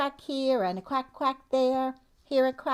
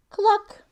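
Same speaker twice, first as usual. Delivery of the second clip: high, loud clucking hen; bright playful cluck for toddlers; 12 semitones higher, 9 dB louder than usual. Pretend. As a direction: cluck like a chicken. Voicing hen sound for kids.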